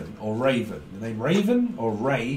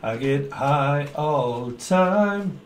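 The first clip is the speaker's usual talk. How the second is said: stoned drawl; much slower than usual. Retold or imitated. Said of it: "it's high of something".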